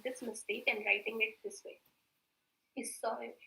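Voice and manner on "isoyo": "Isoyo" with an s sound, softly; correct pronunciation. Shown as a demonstration. Teacher modelling the word as it sounds, soft s pronunciation. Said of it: "That is the correct pronunciation".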